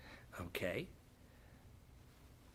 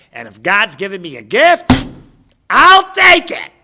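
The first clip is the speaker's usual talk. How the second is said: eager acceptance; 4 semitones higher, 17 dB louder than usual. Acted. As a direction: build to a high, loud shout. Acted eager people accepting the gift.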